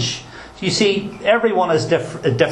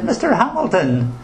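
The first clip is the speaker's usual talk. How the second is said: high telemarketer voice; 8 semitones higher than usual. Retold or imitated.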